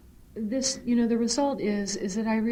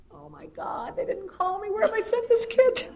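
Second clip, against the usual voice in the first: panic; 12 semitones higher than usual. Retold or imitated. Mother's panicked thought.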